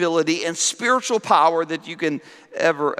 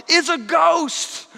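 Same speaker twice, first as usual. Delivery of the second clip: much higher, slow mock terrified cry; frightened disciples; 11 semitones higher, slower than usual. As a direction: wail it like scared fishermen. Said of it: "And this is what they say".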